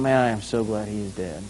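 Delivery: low, soft private gloat; secret inner voice. Acted. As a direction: drop it to a low, soft aside. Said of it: "secretly inside going".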